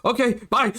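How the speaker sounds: high-pitched, mock-panicked farewell; hasty nervous goodbye. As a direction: squeak it out and flee. Threatened character hurriedly flees.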